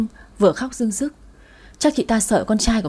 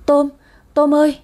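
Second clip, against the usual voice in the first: high, louder shocked call; shocked stammer; 5 semitones higher, 4 dB louder than usual. A narrator's reading. Mother seeing her son stab himself, shocked stammer. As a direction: call his name in stunned alarm. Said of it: "chị lắp bắp".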